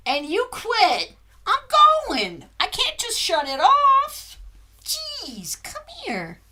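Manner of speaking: high exasperated puppy talk; pet talk to the dog. Real, not acted. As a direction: plead with the impatient dog.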